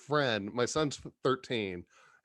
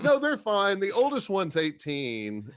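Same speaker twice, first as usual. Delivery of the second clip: higher, louder, mock-casual excuse; breezy panhandler; 6 semitones higher, 4 dB louder than usual. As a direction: toss it off like it's nothing. Panhandler's breezy reply voiced.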